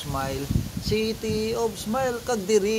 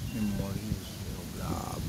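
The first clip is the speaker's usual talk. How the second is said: deep pitch shifted; 10 semitones lower, 10 dB quieter than usual. Acted.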